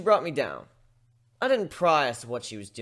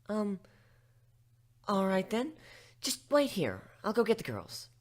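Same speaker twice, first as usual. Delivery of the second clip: higher, flustered librarian voice; startled pony; 7 semitones higher than usual. Acted. Twilight startled by Gilda visiting the library, flustered. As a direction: greet her politely but startled.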